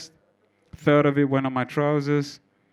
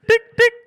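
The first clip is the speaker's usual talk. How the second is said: button beeps; 8 dB louder than usual. Retold or imitated. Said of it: "I was like".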